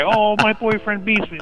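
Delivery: high, whiny imitation; mock crying woman. Caller mimics women crying at Lifetime movies, mock whining. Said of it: "You know, they cry".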